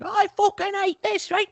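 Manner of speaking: high, cranky put-on British lady; mock Queen. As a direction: squeal it like a fussy royal in a huff. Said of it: "impersonation of, like, how I assume the Queen talks".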